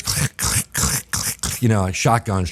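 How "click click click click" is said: whispered clicking of magazines; imitated clicking. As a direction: click it out softly under breath. Mimicking loading magazines.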